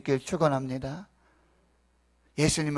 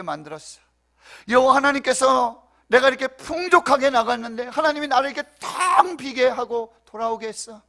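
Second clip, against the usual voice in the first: high, loud wail of complaint; impassioned, raised-voice lament, easing at the end; anguished Naomi; 8 semitones higher, 8 dB louder than usual. Acted. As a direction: cry out against God as Naomi. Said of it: "그렇게 지금 이야기하고 있는 거예요".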